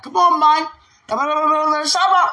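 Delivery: high, loud mock yell; impatient outburst. Woman retelling her impatient outburst, mock exasperated. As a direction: burst out in retold impatience. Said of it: "You know, I was like that".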